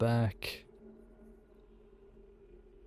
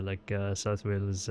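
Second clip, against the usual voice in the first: usual speaking voice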